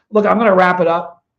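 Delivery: loud, fed up; angry. Real, not acted. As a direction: cut it short, annoyed. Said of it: "a little pissed off".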